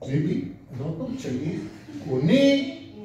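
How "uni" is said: Uni with NI stretched high; stress on NI. Shown as a demonstration. Teacher leads class in a nonexistent stress, stress on NI. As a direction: push up hard on the NI of Uni. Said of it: "Now this one, which does not exist".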